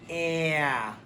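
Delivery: "eh" as a short whiny vowel; "eh" like a mild whine. Shown as a demonstration. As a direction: whine a quick "eh". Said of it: "It sounds kinda whiny".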